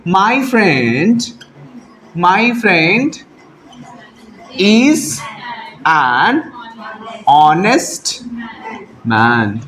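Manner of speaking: slow dictation, pausing after every word. Shown as a demonstration. Teacher dictates the corrected answer to students, word by word. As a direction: dictate each word so the class can write it. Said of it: "answer लिखेंगे".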